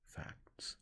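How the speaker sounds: facts said softly, hushed and weighty; stress on facts. A narrator's reading. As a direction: lean on facts. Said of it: "stressing the word facts".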